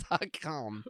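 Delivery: ordinary talk, nothing marked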